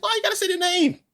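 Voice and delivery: high, exasperated why; exasperated question. Retold or imitated. Complaining about named attacks, high exasperated. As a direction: throw up your hands at it. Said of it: "It's like".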